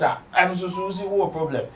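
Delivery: ordinary talk, nothing marked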